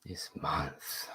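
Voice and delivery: soft unvoiced whisper to himself; whispered musing. Real, not acted. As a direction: breathe the words while thinking. Thinking aloud before answering.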